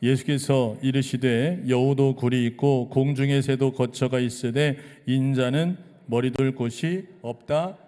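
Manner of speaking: slow, loud scripture reading with the congregation; loud slow unison reading. Shown as a demonstration. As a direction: read Jesus' words out together, firm and drawn out. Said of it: "시작".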